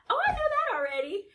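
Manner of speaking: high, loud retold outburst; acting out an emphatic "already knew"; mother's knowing exclamation. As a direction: blurt it as if it's old news. Mother's retold reply to pregnancy news.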